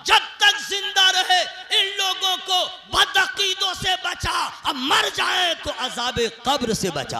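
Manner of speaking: loud, impassioned supplication; full-force prayer. Acted. Preacher praying for the congregation's protection, full-force prayer. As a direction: cry the prayer out over the crowd.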